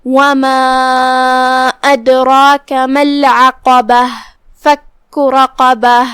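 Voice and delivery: slow, melodic tajweed recitation; Quran recitation. Shown as a demonstration. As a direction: chant the verse slowly as a Quran teacher. Teacher recites the verses. (قرآن کی تلاوت کا انداز)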